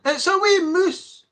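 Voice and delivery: playful mock Scots; Scottish accent. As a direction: put on a broad Scottish accent. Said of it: "As they say in Scotland".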